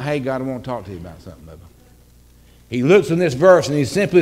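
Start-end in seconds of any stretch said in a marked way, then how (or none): 0.0-1.5 low, soft, quick murmur; offhand prayer. Acted. Someone praying without urgency, offhand prayer. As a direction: toss it off casually under your breath. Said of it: "This is just not".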